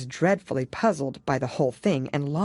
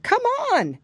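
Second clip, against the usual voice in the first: urgent call; 4 dB louder than usual. Acted. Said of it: "the Gryphon only answered".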